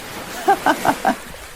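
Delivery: high breathy giggle. Acted. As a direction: giggle in the water. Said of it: "Arcee laughs".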